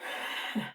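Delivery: soft, breathy little chuckle; quiet, voiceless laugh to oneself; amused laugh. Real, not acted. Tarot reader laughing at drawing her own sign, amused laugh. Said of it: "I'm laughing because I'm Virgo".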